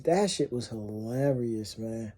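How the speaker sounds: quiet. Shown as a demonstration.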